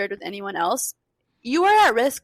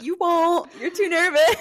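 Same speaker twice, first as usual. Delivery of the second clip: taunting dare, raised higher; mock dare; 6 semitones higher, 5 dB louder, slower than usual. Sister acting out a taunting driver's dare, louder. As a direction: dare them, a bit louder and up. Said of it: "like a threat, like a dare".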